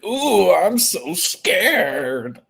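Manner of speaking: long drawn-out "ooh", mocking scared; zesty mock fear. Streamer imitating a boxer's response to his rival, zesty mock fear. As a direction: stretch it out in sarcastic fake fright. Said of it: "Kinda zesty with Floyd part".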